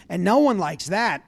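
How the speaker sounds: ordinary talk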